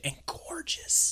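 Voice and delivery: breath-only, drawn-out whisper; admiring whisper. Real, not acted. Praising the actress's performance, in a whisper. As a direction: whisper it slowly in awe.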